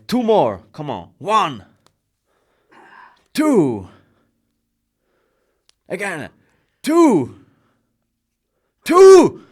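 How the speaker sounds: shouting the count; loud counting. Real, not acted. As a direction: yell the reps like a coach. Counting push-ups like a coach.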